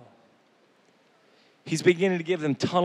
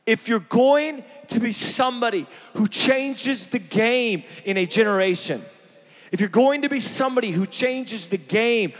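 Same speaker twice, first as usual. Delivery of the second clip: full-force preaching; 6 semitones higher than usual. Acted. Preacher rallying the congregation, full-force preaching. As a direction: preach it like a rallying cry to the crowd. Preacher opening sermon build.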